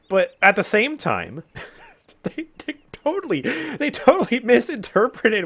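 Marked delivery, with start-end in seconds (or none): 1.8-5.5 high squeaky words through laughter; real helpless laugh breaking the words. Real, not acted. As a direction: crack up while starting the sentence. Cracking up at developers' mistake.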